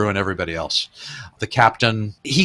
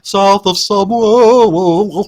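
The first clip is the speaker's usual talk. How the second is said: mock grand title; 9 semitones higher, 6 dB louder, much slower than usual. Real, not acted. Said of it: "but no".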